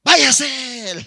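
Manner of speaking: loud, high acted order to leave; outraged dismissal; throwing him out. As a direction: drive him away angrily. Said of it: "le botó".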